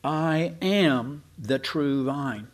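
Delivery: slow, drawn-out "I am", each word weighted; emphatic, unsubtle. Retold or imitated. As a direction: declare "I am" deliberately, at half pace. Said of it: "He's not being subtle".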